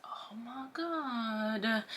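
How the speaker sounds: drawn-out breathless whisper; horrified slow whisper. Retold or imitated. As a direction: breathe it out slowly in dread. Dread as boss is called.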